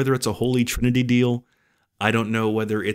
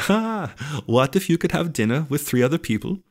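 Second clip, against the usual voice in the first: put-on worst Dutch accent; 5 semitones higher than usual. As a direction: read it out in an awful mock Dutch accent. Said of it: "that was the worst accent".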